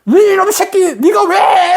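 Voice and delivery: high-pitched imitated parental yell at a kid; strong scolding. Teacher acts out a parent berating a child, imitated yelling. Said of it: "강하게 딱 이렇게 불러가".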